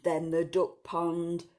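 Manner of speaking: usual speaking voice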